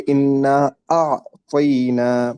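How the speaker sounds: انا اعطینا with the ع of اعطینا from the throat, slow; ain sounded clearly. Shown as a demonstration. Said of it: "یہاں پر کیا ہے". (اعطینا میں عین حلق سے واضح)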